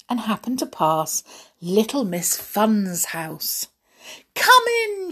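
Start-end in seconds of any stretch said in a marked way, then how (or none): 4.3-5.1 high, loud, merry call; high-pitched hostess calling out; cried out. A narrator's reading. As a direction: sing out an excited invitation. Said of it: "she cried".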